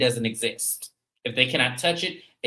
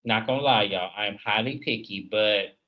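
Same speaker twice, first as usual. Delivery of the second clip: ordinary talk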